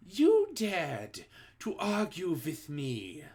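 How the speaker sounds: slow, menacing accusation; a hint of a witch's scream; mild scream. A narrator's reading. Grand High Witch accusing the culprit, slow menacing mock scream. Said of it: "screamed the Grand High Witch".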